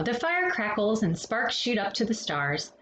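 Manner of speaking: usual speaking voice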